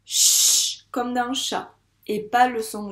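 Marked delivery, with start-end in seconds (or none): 0.0-0.9 "ch" as a long, loud hushing hiss; sustained "ch" for a phonics lesson; isolated ch sound. Shown as a demonstration. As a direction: hold "ch" alone, unvoiced like shh. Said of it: "on entend à nouveau le son".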